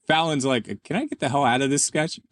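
ordinary delivery

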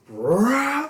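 rising "rah" of a revving engine; imitated engine roar. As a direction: imitate a car dropping a gear and roaring. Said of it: "I just hear".

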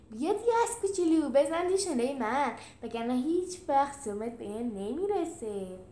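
loud, cocky jeering challenge; a brute's loud mocking dare; taunting. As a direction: goad the little one into fighting. Gladiator taunts Julius.

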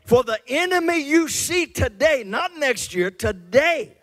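high, loud preacher's call; full-force preaching. Acted. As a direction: thunder it out to the congregation.

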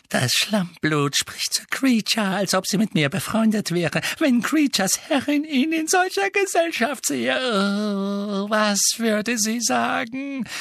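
strained, high-pitched agitated creature; agitated elf muttering. A narrator's reading. Elf grumbling about the girl speaking to him, agitated high whine. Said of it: "sein Murmeln wurde noch schneller und aufgeregter".

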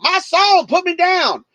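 high, loud mimicry of the drunk; mock pushy regular. DJ mocking the regular, high insistent. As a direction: parrot his demand mockingly. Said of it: "I'm like".

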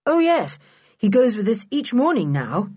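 bright-pitched, courteous reply; polite. A narrator's reading. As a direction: answer politely, voice lifted high. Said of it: "said Julian politely".

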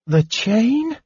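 high, rising, incredulous question; puzzled repeat. A narrator's reading. Kender thinking he misheard mage, baffled question. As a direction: echo it back, pitch climbing in doubt. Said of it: "thinking he must have misunderstood".

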